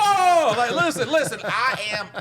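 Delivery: very high, loud plea through laughter; urgent mock plea. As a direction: act out fighting to get a word in. Acting out fighting back the anger.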